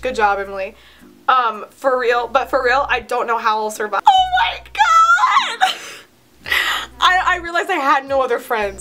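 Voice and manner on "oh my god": very high, squealed outburst; high-pitched squealing exclamation; shriek of disbelief. Real, not acted. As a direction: shriek it in mortified amusement. Shrieking at cringey old writing.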